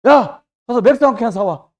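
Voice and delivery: loud, brusque acted command; barked order. Drinker sends someone out for beer, loud barked order. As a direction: call out an errand as a habitual drinker.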